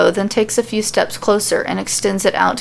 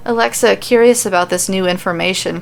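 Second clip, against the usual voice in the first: ordinary delivery, nothing marked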